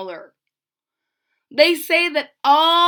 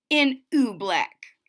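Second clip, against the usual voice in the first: ordinary delivery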